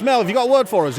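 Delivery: Australian radio host. Acted. Host asking contest caller for a word, Australian accent. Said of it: "this Australian radio station's holding a contest".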